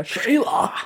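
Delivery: high Australian call; high-pitched joke Australian holler. Pretend. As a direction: call out the name in a mock Australian accent. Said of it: "I imagine she's Australian".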